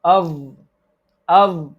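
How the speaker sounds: "Av, av" with a blurred th, tongue stuck out; tongue too far out. Shown as a demonstration. Teacher demonstrates the wrong way, tongue too far out. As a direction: overdo the tongue-out th in "av". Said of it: "if you put much out".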